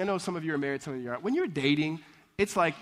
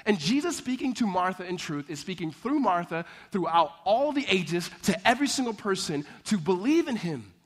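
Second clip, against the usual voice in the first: normal talking voice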